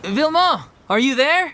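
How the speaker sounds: high searching call. Acted. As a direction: call out for someone out of sight. Calling for someone out of sight.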